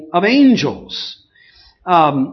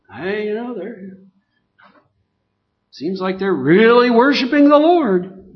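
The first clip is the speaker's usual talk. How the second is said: ordinary talk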